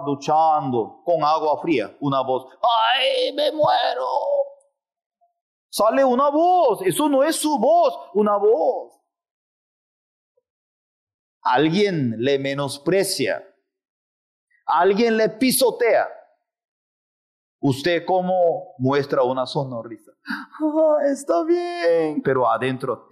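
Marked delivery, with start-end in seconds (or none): 2.6-4.1 high shocked yelp; startled cry. Acted. Man under a cold shower crying out, high yelp. 20.6-22.2 high, slow, strained pleasant voice; fake sweetness. Someone trampled pretending all is fine, high strained sweetness. Forced polite smile.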